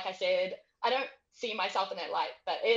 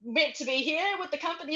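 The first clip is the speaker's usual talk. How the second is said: mock disbelief; 7 semitones higher, 4 dB louder than usual. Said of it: "but, like".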